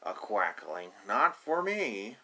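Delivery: loud drawn-out no from the duckling; duckling refusing. Acted. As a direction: stretch out the refusal, whiny.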